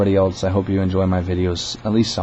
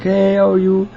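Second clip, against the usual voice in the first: high-pitched, louder greeting; caller's put-on voice; 12 semitones higher, 5 dB louder than usual. Caller getting on the phone with the manager, high mimicking voice. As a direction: imitate an eager caller, pitched way up. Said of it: "and he's like".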